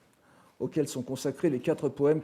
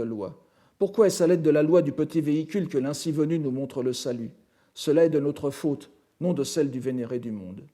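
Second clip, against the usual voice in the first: firm, projected sutra recitation; reading scripture aloud; 4 dB louder than usual. Acted. Lecturer citing Shariputra's address to the Buddha, projected reading voice. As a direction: declaim the disciple's confession as a quoted text.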